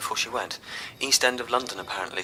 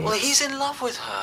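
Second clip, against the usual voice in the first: much higher-pitched sibling jab; needling retort; 13 semitones higher than usual. Acted. Brother accuses Daniel of being in love, needling retort. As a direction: throw it back high and needling.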